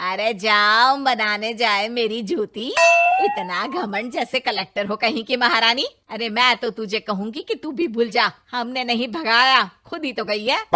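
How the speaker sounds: scornful mother-in-law. Acted. Mother-in-law scoffs loudly.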